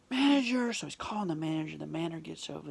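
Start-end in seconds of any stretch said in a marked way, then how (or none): 0.1-0.7 high, loud mock summons; put-on indignant call across a store. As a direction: imitate someone calling for staff. Acts man calling for manager.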